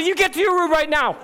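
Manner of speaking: father yelling at his son to go to his room; shout. Retold or imitated. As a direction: bark the order at the son.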